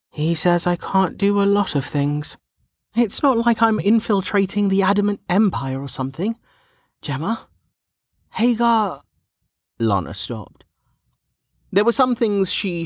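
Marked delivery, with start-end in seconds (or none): 0.1-9.0 put-on high-pitched young woman, aggrieved; sighing complaint. A narrator's reading. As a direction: grumble about him in a high character voice. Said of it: "She sighed".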